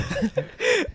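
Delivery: squealing laugh; high-pitched laugh. Real, not acted. Laughing hard.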